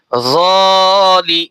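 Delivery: very slow, long stretched vowel; slow Quranic spelling blend; drawn-out blend. Shown as a demonstration. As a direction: stretch the alif one beat. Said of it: "এক আলিফ করে টান দিয়ে পড়তেছি".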